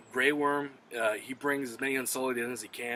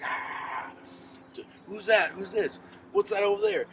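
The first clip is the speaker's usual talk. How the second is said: high, loud panicked cries; panicked confusion; 9 semitones higher, 5 dB louder than usual. Retold or imitated. Dragon riders blinded by the ice storm, crying out high in panic. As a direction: voice them lost and scared in the storm.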